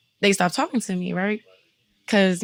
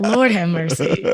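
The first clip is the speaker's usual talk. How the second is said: laughing; 5 dB louder than usual. Real, not acted. Exasperated laugh at a misunderstanding.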